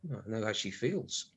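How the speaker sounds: low, soft snide aside; muttered aside. Acted. Husband mocking his wife under his breath, low and soft.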